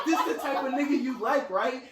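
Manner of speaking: high, giggling fake jealousy; laughing mock accusation. Accusing his girlfriend over a dream, laughing. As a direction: tease her while laughing at yourself. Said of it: "And I'm laughing 'cause I know I'm being crazy".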